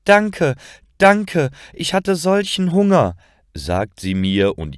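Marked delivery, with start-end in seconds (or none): 0.0-3.2 high, louder, grateful outburst; overjoyed thanks. A narrator's reading. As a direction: gush thanks in a high-pitched voice. Beggar overjoyed at getting food.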